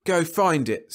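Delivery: high, loud angry retort; hot snap. Acted. Rice defying Leffler, hot angry snap. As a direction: snap it hotly. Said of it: "snapped Rice hotly".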